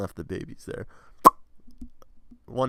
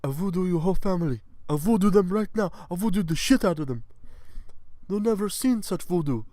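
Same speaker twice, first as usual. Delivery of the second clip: loud, high put-on villain voice; hammed-up menace, pitched up; threatening trafficker; 9 semitones higher, 5 dB louder than usual. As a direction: bellow voodoo threats in character. Acts trafficker making voodoo threats.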